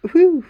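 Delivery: loud, high whoosh of relief; relieved whew. A narrator's reading. Polly after the witch leaves, loud relieved whew. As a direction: blow out a big relieved whew. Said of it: "letting out a long breath of relief".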